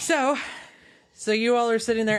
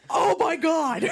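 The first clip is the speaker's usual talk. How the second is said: frustrated; 5 semitones higher, slower than usual. Acted. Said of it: "Siobhan just gets frustrated".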